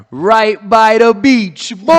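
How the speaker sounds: loud, drawn-out mock Jamaican; fake Jamaican accent. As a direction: belt it out slowly in a phony Jamaican accent. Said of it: "he's using this fake Jamaican accent".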